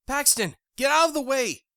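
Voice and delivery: high, loud order; sharp order. Acted. Jackie ordering Paxton aside, loud order. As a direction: command him to move. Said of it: "Jackie ordered from behind me".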